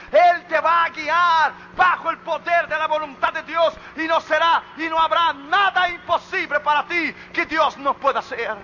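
high, loud, chanting exhortation; full-force preaching. Real, not acted. Preacher exhorts the congregation to praise God, full-force preaching. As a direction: proclaim it in driven, rising phrases.

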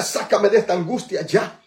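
loud, high call to repeat; forceful preacher's call-and-response; rallying declaration. Real, not acted. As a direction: lead the crowd in a cry for deliverance. Leads congregation in loud declaration.

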